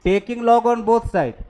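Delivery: very slow, spaced-out dictation. Shown as a demonstration. Teacher dictating a maths step to students, very slow. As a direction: dictate each word so they can write. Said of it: "दोनों side log लो".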